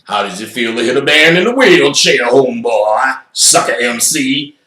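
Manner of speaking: loud mocking voice; taunting wheelchair man. Man in the wheelchair taunting again, loud and mocking.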